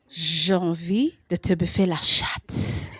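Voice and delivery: put-on low, slow, lightly French-accented seducer; French lover. Comic voicing the French match's text, low light French accent. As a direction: purr it like a smooth Frenchman, lightly. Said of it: "then he says to me".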